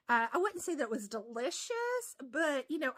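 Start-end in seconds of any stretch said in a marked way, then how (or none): none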